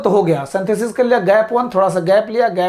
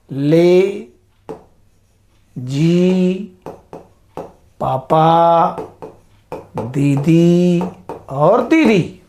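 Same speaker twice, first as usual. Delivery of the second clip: slow, drawn-out mnemonic words with long pauses between; mnemonic spelled out; 4 semitones lower, much slower than usual. Shown as a demonstration. Teacher spelling out a memory trick for students, slow and spaced. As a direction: lay out each memory word one by one. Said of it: "इसको याद करना हो".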